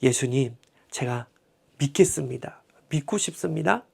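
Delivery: soft, hushed plea of faith; humble whispered prayer. Retold or imitated. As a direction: pray it under your breath, humbly. (믿음 없음을 고백하는 아버지의 속삭이는 기도)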